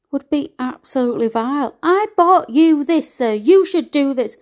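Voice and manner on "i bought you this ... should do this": raised, higher, louder imitation of a nasty ex; mock angry ex. Retelling what her ex demanded, in a loud mocking voice. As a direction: bark out his demands in a mock version. Quoting ex being vile.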